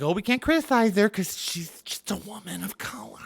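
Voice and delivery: whispered mock concern; mock timid defenders. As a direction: whimper it in a hushed, pitying whisper. Mocks her timid defenders.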